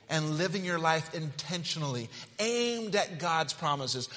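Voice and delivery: normal talking voice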